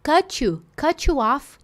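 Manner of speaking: "cut you" joined as "cutchu", T turning to CH; CH sound. Shown as a demonstration. Teacher pointing out the CH in the student's line. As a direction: blend the T into the "you": "cut-chu off". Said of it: "De onde veio esse tchutchucão".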